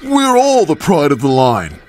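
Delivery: Henry's voice. Acted. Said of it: "huffed Henry".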